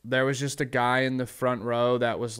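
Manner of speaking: normal talking voice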